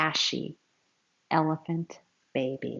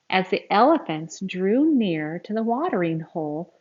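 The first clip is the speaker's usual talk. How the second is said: usual speaking voice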